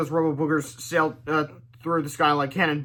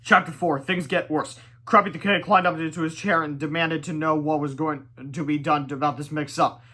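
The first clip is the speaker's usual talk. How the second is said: usual speaking voice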